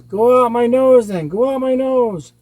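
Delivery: high, loud agonized wail; monster in pain. A narrator's reading. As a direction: cry out as a writhing monster. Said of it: "the monster's writhing in pain".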